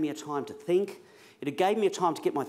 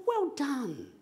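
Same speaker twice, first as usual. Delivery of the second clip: Well done said much higher with a falling end; imitating her praise; 11 semitones higher than usual. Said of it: "She said".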